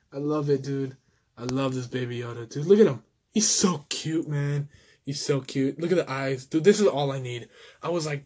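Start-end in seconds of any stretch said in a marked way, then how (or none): none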